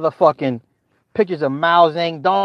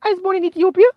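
put-on very high child voice; little girl stating her name, very high; faster than usual. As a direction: answer brightly like a little girl.

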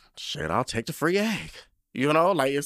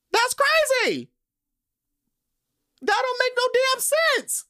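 much higher and louder, exasperated squeal; emotional outburst; 6 dB louder than usual. Real, not acted. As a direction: let it fly up into an incredulous shriek. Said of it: "I know I'm getting emotional".

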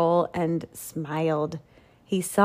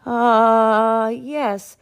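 slow, flustered stalling for time; stammering; 7 semitones higher, 5 dB louder, much slower than usual. Acted. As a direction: drag it out, hunting for words. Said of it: "Walter stammered".

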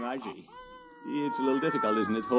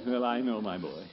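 ordinary talk

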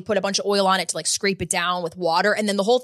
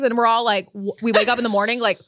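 usual speaking voice

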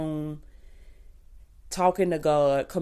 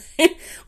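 loud laugh; 7 dB louder than usual. Real, not acted. Laughs about getting comfortable.

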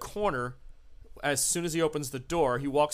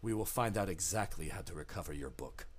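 low, soft, quick ominous promise; a sinister host reassuring his guests; host's promise; 9 semitones lower, 10 dB quieter, faster than usual. Acted. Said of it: "and he says".

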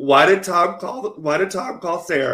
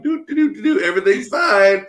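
high, sing-song humming into chirpy denial; mock oblivious mom; 5 semitones higher than usual. Mother ignoring the bruised man, mock sing-song cheer. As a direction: trill it like nothing is wrong. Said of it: "But the mom is just like".

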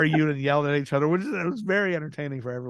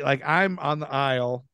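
ordinary talk, nothing marked

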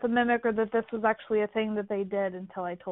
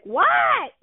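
high, loud answering shout; calling back to the husband; shouted reply; 5 dB louder than usual. Retold or imitated. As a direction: yell back from camp. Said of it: "I'm like".

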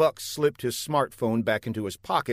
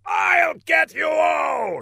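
high, drawn-out villain yell; furious shout; 5 dB louder, slower than usual. A narrator's reading. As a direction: shout the threat, slow and high. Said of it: "shouted Laszlo".

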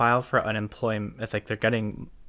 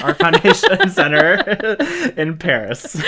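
laughing; 8 semitones higher, 10 dB louder than usual. Real, not acted. Gives up on French name.

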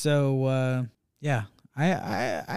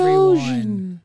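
drawn out; 9 semitones higher, 5 dB louder, much slower than usual. Acted. Dramatic drawn-out word.

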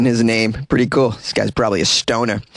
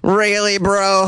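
incredulous; 8 semitones higher, slower than usual. Real, not acted. Incredulous reaction to a threat.

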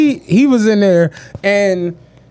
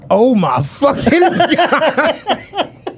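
laughing disbelief; 5 semitones higher, much slower than usual. Retold or imitated. Friend retelling shock, laughing.

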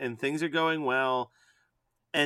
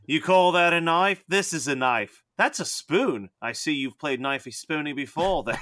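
cartoon Australian movie quote; Australian accent. Quoting the Simpsons go to Australia episode, Australian accent. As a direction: do the Aussie knife challenge. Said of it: "the Simpsons go to Australia".

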